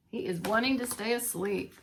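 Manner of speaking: plain reading voice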